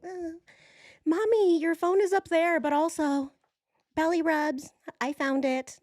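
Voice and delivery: put-on high cutesy baby voice for the cat; cat's cute voice. Owner voicing her cat Gia, high and cutesy. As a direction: talk like a sweet little pet speaking to mommy. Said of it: "She's like".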